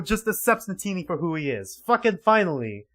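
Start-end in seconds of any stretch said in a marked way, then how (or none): none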